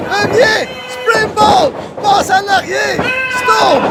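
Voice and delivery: excited high play-by-play shouting; wrestling commentator. Acted. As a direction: call every move like a ringside hype man.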